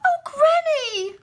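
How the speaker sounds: very high, crying out slowly; a little girl on the edge of tears; frightened girl. Acted. Girl scared of granny's face, crying out high.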